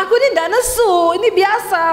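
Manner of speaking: high-pitched indignant protest; angry-sounding denial. Acted. Wife denying anger to husband, in an angry-sounding tone. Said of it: "nadamu tuh nesu".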